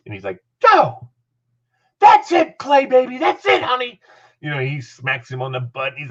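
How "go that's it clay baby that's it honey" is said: high hollered praise; Dick Adams. Retold or imitated. Coach praising the freshman mid-drill, high excited hollering. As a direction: cheer the player on at a high pitch. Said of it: "He'd praise him".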